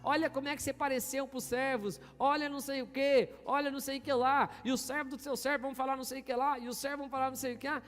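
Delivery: mocking Michal. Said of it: "Ela começa a falar".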